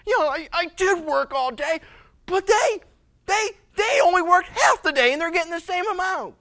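high loud complaining worker; whining. Acted. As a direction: whine about the unfair pay. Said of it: "quit your whining".

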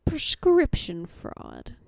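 slow, heavily paused delivery; super dramatic pauses. Retold or imitated. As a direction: draw it out with long dramatic pauses. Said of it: "Like, super paused".

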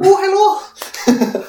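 high, slow stretched Irish greeting; Irish accent. Pretend. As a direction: sing out a hello in an Irish lilt. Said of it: "Sounds Irish".

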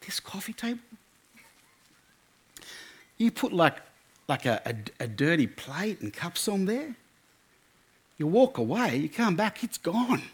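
soft wonder, as if revealing a miracle; in awe. Retold or imitated. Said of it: "like in awe".

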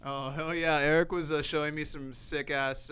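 normal talking voice

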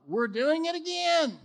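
high, loud comic disbelief; mock exasperation. Preacher points out Abraham repeating the sister scheme, high mock exasperation. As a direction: exclaim it like you can't believe it.

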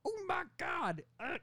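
very high, bad New York accent; Tom Hardy. Boss appalled at his employee, bad New York accent. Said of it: "he's doing the same voice from Venom".

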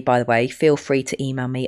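ordinary delivery

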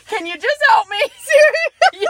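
high laughing plea, near tears. Retold or imitated. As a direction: plead while laughing, voice cracking. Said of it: "I probably said".

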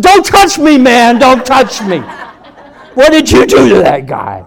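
high, loud panicky protest; hammed-up frightened yell; alarmed shouting. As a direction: shout as if warding someone off. Frightened brother recoils from the preacher.

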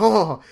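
loud high mock gasp of fright; startled gasp. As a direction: play the jumpy mom caught off guard. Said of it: "and she'll be like".

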